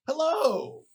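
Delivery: high, showy greeting; Christmas tree man. Retold or imitated. Costumed man on one knee to passengers, mock-theatrical hello. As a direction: imitate a costumed man greeting his audience. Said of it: "And the Christmas tree just fucking".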